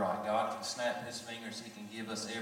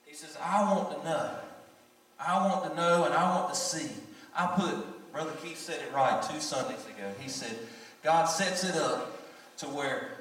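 loud, high, fervent sermon delivery; full-force preaching; 7 semitones higher, 8 dB louder than usual. Acted. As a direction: preach it out to the congregation.

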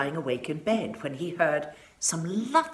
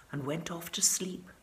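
whispered narration; 8 dB quieter than usual. A narrator's reading.

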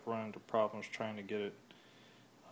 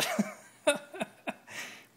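short laugh; 4 dB louder than usual. Real, not acted. Laughs after mishap.

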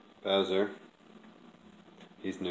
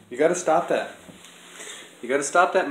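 scolding; 6 semitones higher, 4 dB louder than usual. Real, not acted. Scolding cat.

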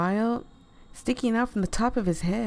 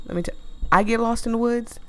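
ordinary delivery, nothing marked

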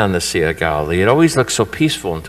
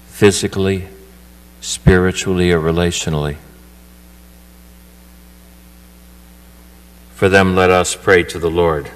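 louder, steady liturgical prayer voice; intercessory prayer; 5 dB louder than usual. Acted. Priest leading the prayers of the faithful at Mass, solemn and steady. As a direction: lead the congregation in petitions.

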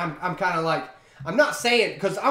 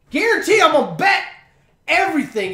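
much higher and louder, bursting out; shouting a bet to his viewers that the story is clickbait; sudden shout; 10 semitones higher, 9 dB louder than usual. Real, not acted. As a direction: call the bet loudly before clicking. Predicting the clickbait is fake.

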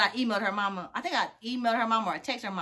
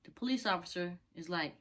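ordinary delivery

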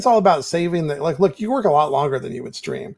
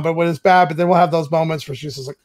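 ordinary talk, nothing marked

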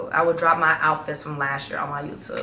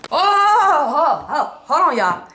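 high, loud oh oh hold on; sudden realisation; 9 semitones higher, 6 dB louder, slower than usual. Real, not acted. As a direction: catch yourself as you remember. Suddenly remembers, calls back.

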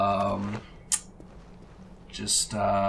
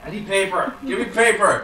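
demanding; 7 semitones higher than usual. Real, not acted. Demanding paper.

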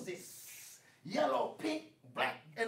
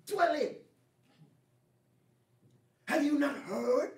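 ordinary delivery

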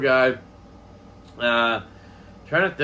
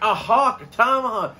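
high, raised mock realization; retold sudden realization; 7 semitones higher, 4 dB louder than usual. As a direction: re-enact the penny finally dropping.

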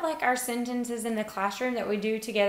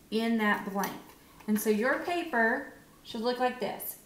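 ordinary delivery

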